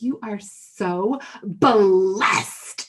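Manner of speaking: very slow, loud, drawn-out emphasis; aggressive blessing. Retold or imitated. Older woman to triplet mom in the store, aggressively gushing. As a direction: stretch it out, then punch BLESSED hard. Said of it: "It was so aggressive".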